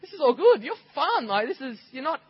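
put-on young son telling mum she's fun at home; delighted little boy. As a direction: sound like a small kid discovering mum is fun. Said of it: "I was like".

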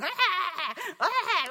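high, loud mocking laugh; spirit of death. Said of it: "spirit of death sitting on it going".

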